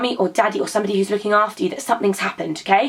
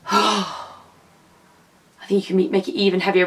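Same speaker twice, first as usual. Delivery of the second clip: straining under weight; slower than usual. Pretend. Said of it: "it's gonna be really heavy".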